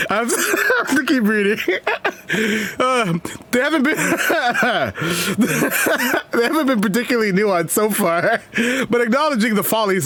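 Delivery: real giggles breaking into every phrase; laughing while reading. Real, not acted. Cannot stop laughing while reading.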